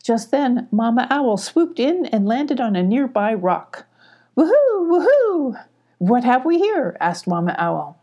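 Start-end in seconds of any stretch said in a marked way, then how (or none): 4.4-6.9 high, loud hooting owl call. A narrator's reading. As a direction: hoot it out like a cheerful owl. Mama Owl swoops in hooting.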